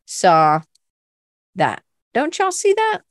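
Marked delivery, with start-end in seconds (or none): 2.1-3.0 high-pitched, incredulous plea; playful insistence. Real, not acted. Urging the class to see what she sees, high and incredulous. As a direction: squeak it out as an eager question.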